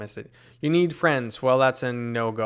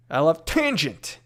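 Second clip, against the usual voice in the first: normal talking voice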